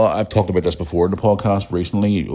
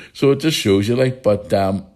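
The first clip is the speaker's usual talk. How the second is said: ordinary talk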